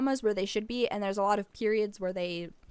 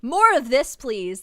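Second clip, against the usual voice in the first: excited; 3 semitones higher, 9 dB louder, slower than usual. Real, not acted. Delighted by the art.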